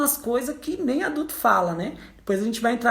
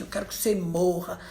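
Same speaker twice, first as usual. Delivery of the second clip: put-on a hushed, softer child's wish; child's quiet curse; 3 semitones lower, 5 dB quieter than usual. Small child mutters his wish at his mother, hushed. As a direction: drop it low and quiet, a hint of a sulky child. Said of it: "E faz assim".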